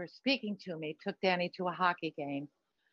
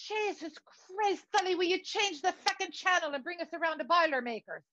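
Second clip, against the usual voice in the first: high-pitched exasperated complaint; exasperated demand; 7 semitones higher than usual. Acted. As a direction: drag out the oath, then demand. Exasperated demand to change channel.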